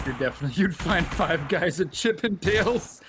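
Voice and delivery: giggling through the words; laughing at own joke. Real, not acted.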